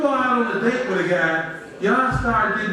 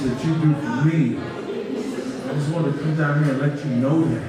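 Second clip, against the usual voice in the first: talking sweetly to her private parts; 8 semitones lower than usual. Acted. Man kneels and sweet-talks his sleeping girlfriend's body, low and tender. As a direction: coo it low like courting a lover. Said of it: "I'll be like".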